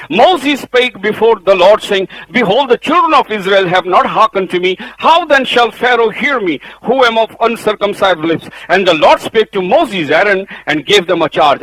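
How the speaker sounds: full-voiced sermon reading; preaching scripture. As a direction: proclaim the verse from the pulpit.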